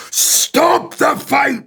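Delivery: drawn-out, high-pitched scream; full-force scream. Shown as a demonstration. Actor screaming a film line to get into character, full-force drawn-out scream. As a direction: scream each word long, high and loud. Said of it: "I would scream that".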